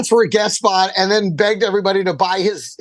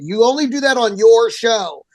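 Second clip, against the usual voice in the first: usual speaking voice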